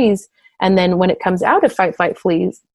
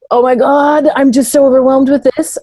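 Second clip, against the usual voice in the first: higher, louder mock panic; overwhelmed; 5 semitones higher, 5 dB louder than usual. Stressed mother voicing her overload, higher and louder. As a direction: play the frazzled mom at breaking point. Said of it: "starting to feel myself getting".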